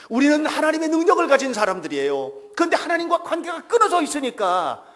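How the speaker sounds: loud, fervent sermon delivery; preaching at full force. Acted. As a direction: preach it out with full conviction to the congregation. Preaches loudly.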